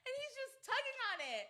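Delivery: high squeals of laughter through the words; cracking up so hard it almost sounds like crying; laughing hard. Real, not acted. Laughing at the child on camera.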